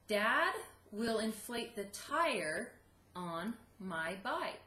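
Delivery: slow, careful dictation. Shown as a demonstration. Teacher dictating to second graders, slow. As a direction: spell it out slowly for young writers. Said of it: "read it again slowly for you".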